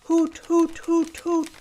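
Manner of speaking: high repeated hoots; hoot, hoot like an owl. Shown as a demonstration. Owl teacher demonstrating hoots to the little pig. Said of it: "Now put an H sound on the beginning".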